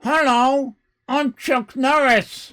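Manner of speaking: hammed-up Chuck Norris. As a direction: ham up the action hero's hello. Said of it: "Instantly recognizable".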